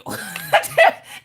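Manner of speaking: bursts out in a high unvoiced laugh; real laugh at a comment; sudden high laughter. Real, not acted.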